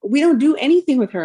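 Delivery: high, loud retold protest; incredulous protest. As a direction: protest to the doctor in disbelief. Retelling her protest to doctor.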